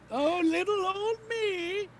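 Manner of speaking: put-on high, slow frail old woman; frail shaky old whisper. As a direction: quaver like a helpless granny.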